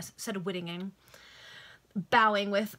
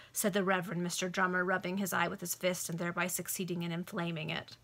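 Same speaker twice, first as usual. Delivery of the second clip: ordinary delivery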